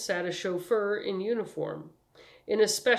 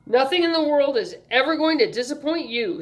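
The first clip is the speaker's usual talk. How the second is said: loud, higher-pitched protest; harried man's emphatic comeback; exasperated retort; 7 semitones higher, 8 dB louder than usual. A narrator's reading. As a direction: fling it back at her, fed up. Exasperated man answering her.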